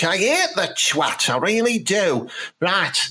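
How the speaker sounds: ordinary talk, nothing marked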